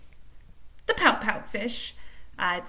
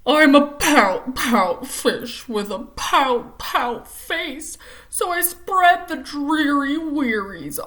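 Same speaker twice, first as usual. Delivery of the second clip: slow, louder, mopey singsong refrain; gloomy pout fish; 8 dB louder, much slower than usual. Acted. Teacher reads the pout fish's gloomy refrain, slow and loud. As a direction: drag out every word like a sulking fish.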